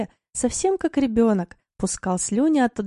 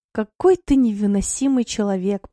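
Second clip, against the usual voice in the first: plain reading voice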